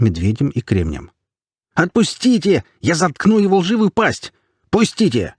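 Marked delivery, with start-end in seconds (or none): 1.7-5.3 high-pitched struggling outburst; squealing, furious demand; frantic yelling. A narrator's reading. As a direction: cry out while being held back. Hamster struggles, held back, yelling.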